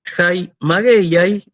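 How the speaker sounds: slow, careful Greek: "Jai mageiai" drawn out; Greek pronunciation. Shown as a demonstration. Teacher models "Jai mageiai" for class, slowly. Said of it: "Fíjense cómo cambió el acento".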